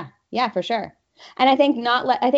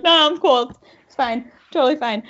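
higher, breezy mock brush-off; mock "I'm fine"; 5 semitones higher than usual. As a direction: pretend you're fine, voice up high. Crying on train, faking fine.

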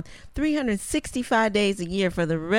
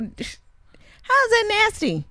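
very high, slow disbelief; incredulous; 11 semitones higher, much slower than usual. Real, not acted. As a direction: stretch it out incredulous. Incredulous challenge.

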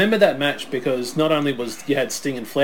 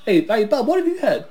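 mock American; 9 semitones higher, 3 dB louder than usual. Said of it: "It's mate".

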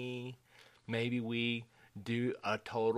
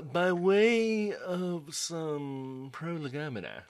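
slow, lofty, higher and showy; pompous smart voice; 6 semitones higher, 4 dB louder, much slower than usual. Shown as a demonstration. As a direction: say it to sound smart. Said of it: "if you really wanna sound smart".

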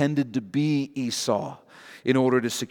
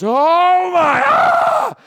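high, loud cry of horror; shocked scream; 8 dB louder, much slower than usual. Acted. Jacob waking beside the wrong bride, screaming in shock.

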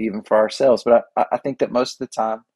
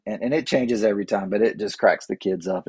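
ordinary talk, nothing marked

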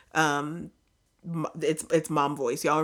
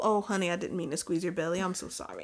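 higher, fussing sorry voice; fussing apology; 5 semitones higher, faster than usual. Retold or imitated. To the wife after squeezing her, higher fussing apology. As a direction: fret over her like a worried mother.

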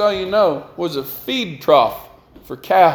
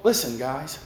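normal talking voice

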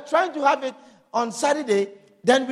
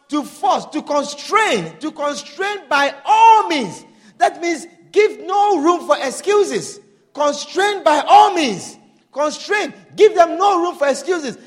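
slow and louder, every word driven home; slow forceful preaching; 5 semitones higher, 5 dB louder, slower than usual. Acted. Preacher drilling the meaning of anakazo into the church, forceful. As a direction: preach it down hard, no excuses.

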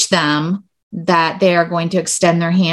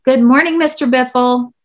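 bright class reply; 6 semitones higher than usual. Shown as a demonstration. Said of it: "Not too bad".

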